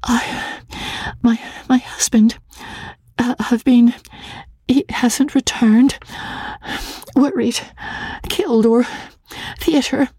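high, halting, breathy stammer; frightened wife telling the clerk her husband is missing; near whisper. A narrator's reading. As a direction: barely get the words out. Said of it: "Her voice was little more than a whisper".

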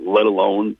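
ordinary talk, nothing marked

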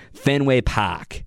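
slow Boston accent, Park said as pahk without the r. Shown as a demonstration. Host repeats the ballpark name for listeners in a Boston accent. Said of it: "with the real Beaner Boston accent".

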